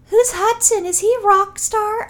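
put-on high, slow, childlike question; little kid voice. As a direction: ask it innocently like a small child.

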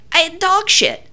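high-pitched, emphatic self-quote; exasperated insistence. Retold or imitated. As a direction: insist on it to someone not listening. Insisting to an inattentive friend.

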